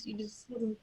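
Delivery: breathy whispered aside. Real, not acted. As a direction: drop to a whisper mid-sentence.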